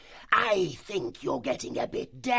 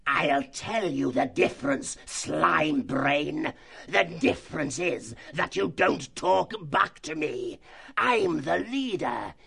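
higher, slow, shouted fox threat; harsh grating; 10 semitones higher, slower than usual. Acted. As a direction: grate it out while lashing the stoat. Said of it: "Slagar's voice grated harshly".

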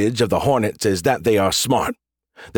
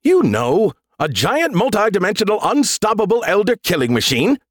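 relishing; 9 semitones higher than usual. Acted. Skippy relishing the threat.

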